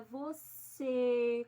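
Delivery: "você" with a long, closed final "ê". Shown as a demonstration. Teacher explaining the circumflex, "você" with drawn-out closed E. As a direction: hold the closed last vowel of "você". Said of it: "com o E fechado".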